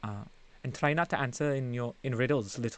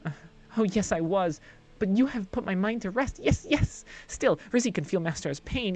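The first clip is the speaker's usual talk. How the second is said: high, quick, fawning sprite voice; a giddy small servant creature gushing about Master's things; eager little creature; 6 semitones higher, 4 dB louder than usual. Acted. Reezy the creature greets Hestia, high and eager.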